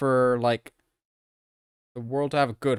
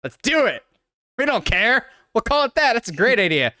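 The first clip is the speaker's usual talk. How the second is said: gleeful producers; 8 semitones higher, 3 dB louder than usual. Retold or imitated. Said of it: "And they're like".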